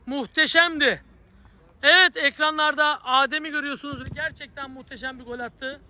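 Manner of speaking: ordinary talk, nothing marked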